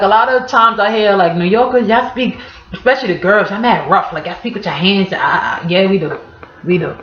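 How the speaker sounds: ordinary talk, nothing marked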